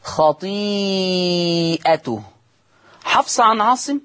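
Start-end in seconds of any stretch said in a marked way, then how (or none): 0.0-2.2 خطيئته drawn out slowly in recitation; Hafs reading. Shown as a demonstration. Teacher models Hafs reading of خطيئته, slow. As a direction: chant خطيئته with long vowels. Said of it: "كلمة خطيئته".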